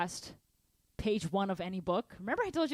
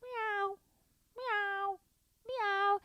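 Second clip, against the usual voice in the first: high, soft imitation of a cat; cat meow; 10 semitones higher, 5 dB quieter, much slower than usual. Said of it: "I'm gonna hear at the door".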